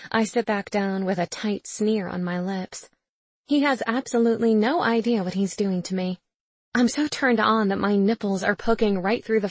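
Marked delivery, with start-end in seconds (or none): none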